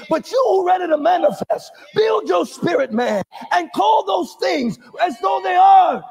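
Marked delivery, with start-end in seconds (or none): none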